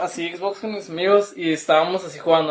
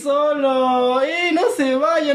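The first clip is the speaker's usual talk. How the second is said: much higher, slow whiny plea; whiny kid plea; 7 semitones higher, much slower than usual. Retold or imitated. Corderito begging friends not to leave him, high whiny plea. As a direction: wail like an abandoned little kid. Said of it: "bien niño".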